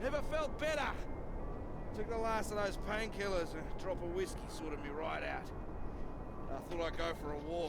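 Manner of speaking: loud, high, boozy swagger; drunk bravado. Acted. Drunk Floyd boasting to his companion, loud and high. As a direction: boast like the whiskey's talking.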